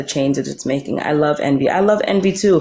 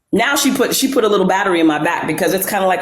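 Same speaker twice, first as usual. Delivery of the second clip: ordinary delivery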